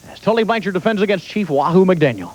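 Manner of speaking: ordinary talk, nothing marked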